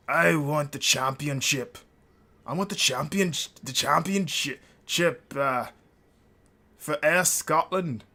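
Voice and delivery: slow, hammed-up Scottish accent; second-try Scottish accent. Drew McIntyre asking for the championship in a hammed-up Scottish accent. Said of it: "horrible Scottish accent".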